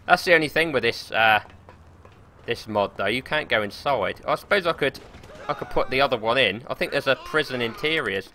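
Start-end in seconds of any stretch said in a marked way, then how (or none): none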